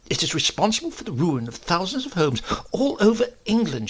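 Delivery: shrill moral crusader; preaching spinster. A narrator's reading. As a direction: lecture him with righteous fervour. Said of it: "she said".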